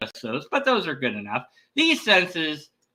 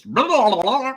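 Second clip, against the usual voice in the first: mock alien babble, rising louder; creature gibberish; 7 semitones higher, 5 dB louder than usual. Strange guy showing up to speak, mock gibberish. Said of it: "which translates to".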